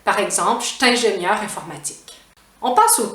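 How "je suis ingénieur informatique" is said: "je suis" as "cht" joined to "ingénieur"; Quebec contraction. Shown as a demonstration. Teacher extending the example, contracted pronunciation. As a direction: run "cht'ingénieur informatique" together. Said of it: "on va dire".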